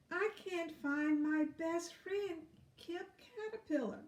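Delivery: a small worried bug; sad ladybug. Acted. Ladybug voiced in story.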